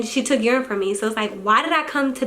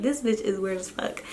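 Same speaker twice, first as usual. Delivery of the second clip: muttered aside; 2 semitones lower, 8 dB quieter than usual. Retold or imitated. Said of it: "I'm like".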